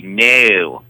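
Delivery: "no" with a long, fronted Maryland O. Shown as a demonstration. Showing co-hosts the Maryland accent, "no" with a stretched O. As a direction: draw out the O in "no" the Baltimore way. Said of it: "work on your O's".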